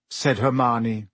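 usual speaking voice

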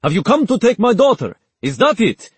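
loud, high, accusing shout; angry sheikh. A narrator's reading. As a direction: roar the accusation. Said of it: "the Sheikh bellowed".

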